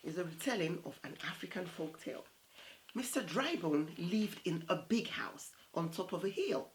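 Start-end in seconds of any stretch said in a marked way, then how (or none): none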